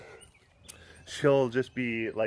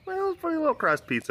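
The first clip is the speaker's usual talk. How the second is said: higher, quicker coo; faster than usual. Real, not acted. Admiring a pet's crossed paws, cooing baby-talk.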